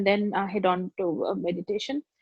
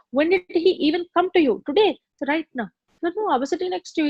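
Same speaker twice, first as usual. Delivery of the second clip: higher, quicker protesting; insistent; 7 semitones higher, faster than usual. Retold or imitated. Student protesting to crying classmate, insistent. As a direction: press her with disbelief.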